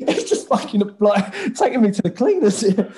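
amused, exasperated comeback; laughing retort. Retold or imitated. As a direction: retell the protest through real laughter. Retelling a comic argument, laughing.

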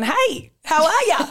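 high, chirpy copy of her hello; imitated eager greeting. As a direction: mimic your friend's overeager greeting. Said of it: "you've just gone".

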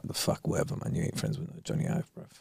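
whispered scoffing aside; hushed, muttering disbelief. Retold or imitated. Staff whispering about the customer, scoffing under breath. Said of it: "We're like".

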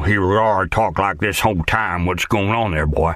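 put-on a lightly done West Virginian accent, teasing. Actor showing the casting agent his West Virginian accent. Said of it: "you need to be able to do a West Virginian accent".

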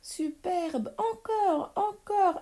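put-on thrilled child's encore cry; jubilant cheering. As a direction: egg them on in squealing delight. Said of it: "applaudit Pierre en jubilant".

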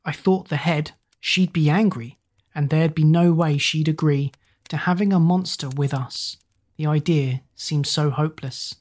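ordinary delivery

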